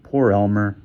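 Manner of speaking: ordinary delivery, nothing marked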